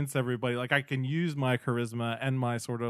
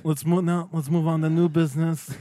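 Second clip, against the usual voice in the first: raised rasping mafia boss voice; loud, commanding Godfather boss; Don Corleone impression; 3 semitones higher, 4 dB louder than usual. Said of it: "yours is really good".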